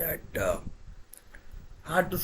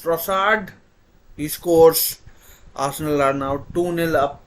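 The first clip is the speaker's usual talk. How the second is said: loud excited goal call; 4 semitones higher, 6 dB louder than usual. Real, not acted. Goal called live.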